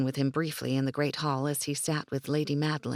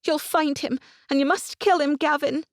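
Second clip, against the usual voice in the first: put-on high, loud, insistent young woman; fierce demand; 12 semitones higher, 6 dB louder than usual. A narrator's reading. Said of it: "Isabel demanded".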